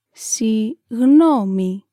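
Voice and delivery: Συγγνώμη stretched very slowly, syllable by syllable; slow teaching repetition. Shown as a demonstration. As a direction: draw out Συγγνώμη for the learner. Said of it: "Let's say it again".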